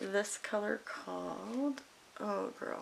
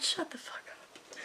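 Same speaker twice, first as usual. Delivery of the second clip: a slow, breathy whispered disbelief; stunned whisper; 9 semitones higher, 5 dB quieter, slower than usual. Retold or imitated. Flattered by kids, she whispers a slow amazed disbelief. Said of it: "I was like".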